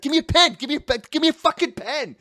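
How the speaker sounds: high, urgent repeated plea; imitating a friend's frantic grab for a pen; frantic begging. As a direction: beg for a pen like the star will vanish any second. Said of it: "one of our friends is like".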